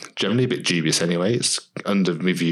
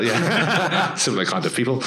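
laughing; 6 semitones higher than usual. Real, not acted. Laughs at name drop remark.

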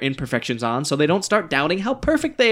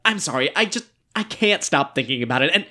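usual speaking voice

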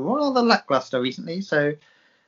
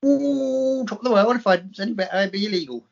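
quoted catchphrase; 6 semitones higher than usual. Pretend. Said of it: "Who used to say that?".

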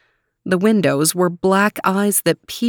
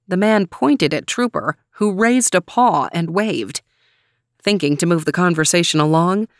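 ordinary delivery, nothing marked

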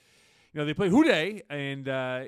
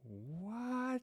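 high, drawn-out, softer disbelief; incredulous; 11 semitones higher, 6 dB quieter than usual. Real, not acted. Host reacts to co-host's roster claim, incredulous. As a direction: stretch it upward in disbelief.

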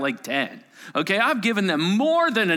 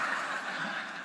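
breaking into a soft laugh; 10 dB quieter than usual. Real, not acted. Pastor laughing at his fellow counselor who fell for the prank.

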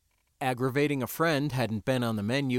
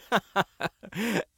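short scornful laugh; mocking laugh; 9 semitones higher than usual. A narrator's reading. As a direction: laugh at him. Sindra laughs.

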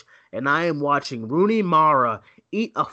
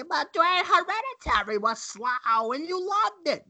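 put-on high, quavery old-grandma voice, much higher; 13 semitones higher than usual. Host teasing his co-host as an old grandma, high voice. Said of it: "You just made yourself sound like an old grandma".

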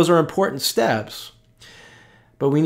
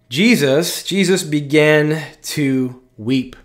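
slow solemn verse; much slower than usual. Acted. Solemn repeated scripture verse.

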